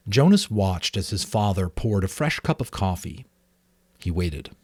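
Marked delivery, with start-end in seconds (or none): none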